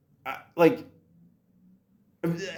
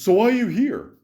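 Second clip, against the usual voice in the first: imitating a brusque doctor, higher and louder; mock demand, pitched up sharply; angry, bitter doctor; 7 semitones higher, 5 dB louder than usual. Doctor sits down and challenges the patient, angry and bitter. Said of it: "he came in all angry and bitter".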